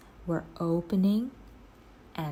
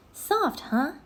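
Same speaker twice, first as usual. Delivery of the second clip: Annie teasing; 11 semitones higher than usual. A narrator's reading.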